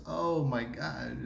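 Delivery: drawn out slowly and earnestly; slow, sincere surrender; slow real-death sigh. Shown as a demonstration. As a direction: let it stretch, very slow and heavy. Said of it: "No, we die like this".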